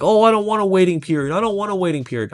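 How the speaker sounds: whiny client. Retold or imitated. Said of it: "they say, like".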